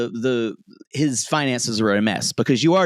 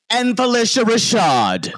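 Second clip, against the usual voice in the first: excited reveal; 11 semitones higher, 5 dB louder than usual. Real, not acted. Big reveal of famous cast.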